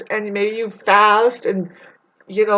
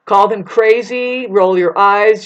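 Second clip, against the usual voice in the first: ordinary talk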